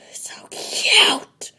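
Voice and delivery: a loud, high, breathy squeal; cute squeal. Real, not acted. Squealing at her hamster being adorable. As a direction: burst with adoration.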